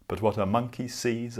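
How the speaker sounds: usual speaking voice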